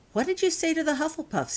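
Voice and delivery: James pulls Holly aside and asks, lightly voiced boy; James, curious. A narrator's reading.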